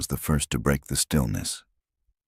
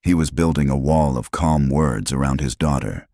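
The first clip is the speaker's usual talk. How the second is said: usual speaking voice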